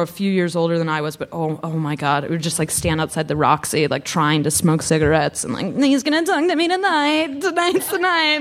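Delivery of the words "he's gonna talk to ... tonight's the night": put-on high, dreamy Italian accent; swooning teen girl in an Italian accent. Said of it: "You sounded a little bit like you were doing an Italian accent".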